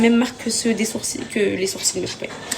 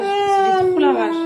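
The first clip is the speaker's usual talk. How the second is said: slow, high, louder "J'ai trop la rage" dragged out in anger; drawn-out rage; 8 semitones higher, 4 dB louder, much slower than usual. Real, not acted. Mother venting to followers about her husband, slow furious outburst. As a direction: stretch every word of "J'ai trop la rage" with real fury.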